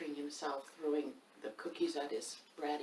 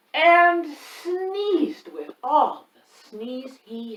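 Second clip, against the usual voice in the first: slow, stretched and louder; 9 semitones higher, 15 dB louder, much slower than usual. Acted. Reader stretches the words loudly to mimic the sneeze. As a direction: draw it out like the sneeze itself.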